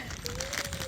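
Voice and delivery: quick squealing giggle; high squeal laugh. Real, not acted.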